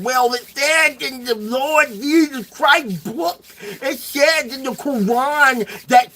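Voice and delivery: slow, drawn-out mocking sermon tone; slow sneering imitation of a preacher. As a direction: parrot the believers, dragging each word out slowly. Mocking religious arguments.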